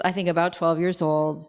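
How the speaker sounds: ordinary delivery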